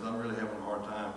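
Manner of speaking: ordinary delivery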